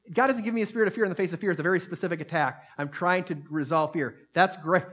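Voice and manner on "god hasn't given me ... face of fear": fast and higher, rattled off in one breath; the preacher to the congregation, a rushed anxious declaration; rushed urgent declaration. Shown as a demonstration. Fire it off fast and pitched up. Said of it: "I don't even need, see".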